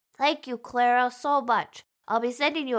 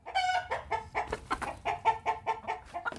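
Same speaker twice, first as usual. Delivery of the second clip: chicken cluck sound; 10 semitones higher, 8 dB quieter than usual. Pretend. Chicken cheer sound effect.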